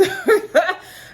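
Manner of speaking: loud high laugh; high burst of laughter. Real, not acted. Laughing at the scene.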